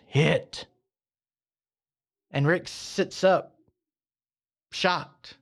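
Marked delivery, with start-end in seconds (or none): 0.1-0.7 a hint of a detached divine voice; God calmly orders the hit. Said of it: "the voice goes".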